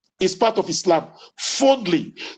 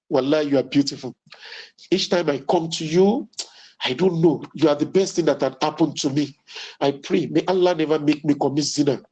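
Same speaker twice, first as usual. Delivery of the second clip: much lower, tender sweet talk to a wife; romantic bedroom voice; 7 semitones lower than usual. Shown as a demonstration. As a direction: coax her lovingly, low and gentle. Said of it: "the way you say it".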